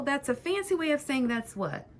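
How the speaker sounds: ordinary talk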